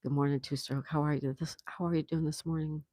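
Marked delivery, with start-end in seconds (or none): none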